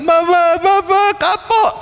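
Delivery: put-on a child wailing that he's learned his lesson; whimpering child begging mama. Child begging parents, repentant.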